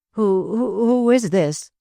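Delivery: high, halting stammer of fright; a startled young man stammering high; stammering shock. A narrator's reading. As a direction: stutter it out, shaken. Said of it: "stammered young Webster".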